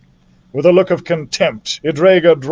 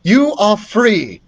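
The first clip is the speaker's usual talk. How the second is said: put-on solemn girl voice; 7 semitones higher than usual. A narrator's reading. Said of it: "said Beatrice".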